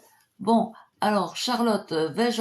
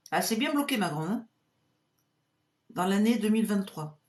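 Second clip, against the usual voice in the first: normal talking voice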